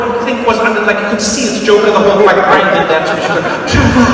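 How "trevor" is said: loud joyful cry of a name; excited cry. Retold or imitated. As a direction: shout the name in delighted recognition. Gran recognising grandson again.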